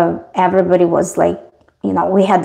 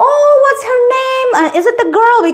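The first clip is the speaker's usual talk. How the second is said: high, sing-song curious coo; cooing question; 13 semitones higher than usual. Retold or imitated. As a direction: coo over the dog in the photo. Cooing question about the dog.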